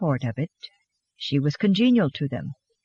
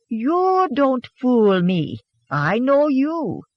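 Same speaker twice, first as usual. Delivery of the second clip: put-on Swedish accent; 7 semitones higher, much slower than usual. A narrator's reading. Anna keeps teasing in accent.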